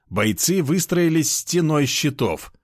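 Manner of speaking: usual speaking voice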